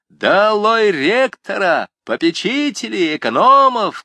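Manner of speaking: high, loud, slow chant of down with; student shouting down the rector; rousing shout. A narrator's reading. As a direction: shout like at a rally. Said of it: "крикнул Жан".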